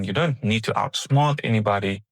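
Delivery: usual speaking voice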